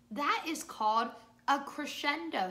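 normal talking voice

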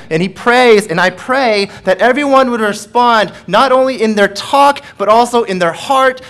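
loud, high, ringing proclamation; preaching at full force. Acted. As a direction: build the sermon to its climax.

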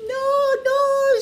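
high piggy voices saying no; high little pigs refusing the wolf. A narrator's reading. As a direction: squeak out a firm no. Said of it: "said the two little Grumpies".

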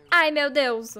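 loud exclamation of delight; overwhelmed gushing. Real, not acted. Streamer holds a little chick, loud overwhelmed delight. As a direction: burst out at the cuteness.

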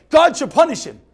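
loud, pointed "God should punish him"; emphatic translation. Shown as a demonstration. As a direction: stress God hard. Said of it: "translating loudly".

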